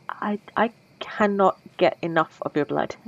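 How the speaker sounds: hushed whisper, hungry; vampire confesses her craving in a whisper. Acted. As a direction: breathe the confession.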